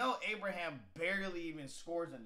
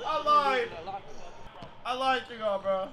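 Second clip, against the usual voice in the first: loud admission; 4 semitones higher, 12 dB louder than usual. Real, not acted. Admitting his pick was wrong.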